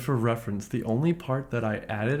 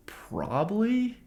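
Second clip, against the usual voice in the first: high, drawn-out "probably"; stretched, reluctant admission; grudging concession; 9 semitones higher, much slower than usual. Real, not acted. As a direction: admit it slowly, pitch way up. Grudging answer to own question.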